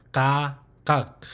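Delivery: "ka" held open, "kak" shut at the back; consonant demonstration. Shown as a demonstration. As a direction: open "ka", then closed "kak". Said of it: "that's how you'll pronounce that consonant".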